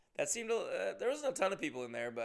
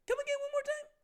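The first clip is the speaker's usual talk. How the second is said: very high, squeaky mock question; exaggerated incredulity; 5 dB louder, faster than usual. Asking cousin once more to repeat it, incredulous. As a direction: squeal it louder, as comic disbelief. Still cannot understand cousin.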